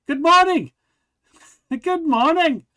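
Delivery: high, loud hammed-up English accent with a laugh; a silly high English "Good morning!"; mock English accent. Reading the article, joking with co-host in a hammed-up English accent. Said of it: "in a well-spoken English accent".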